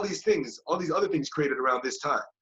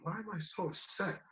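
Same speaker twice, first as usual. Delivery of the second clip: much softer, wondering to himself; inner question, hushed; 5 semitones higher, 12 dB quieter than usual. Retold or imitated. Sitting by the Ganges questioning himself, much softer. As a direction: ask it quietly, inward.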